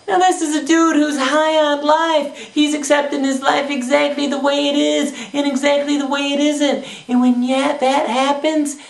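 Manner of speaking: ordinary delivery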